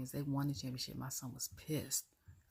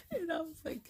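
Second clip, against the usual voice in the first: laughing while speaking; 13 semitones higher than usual. Real, not acted. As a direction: laugh through the words. Laughing at the commentary story.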